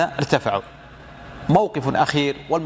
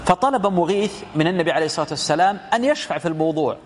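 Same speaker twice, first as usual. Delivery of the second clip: ordinary talk, nothing marked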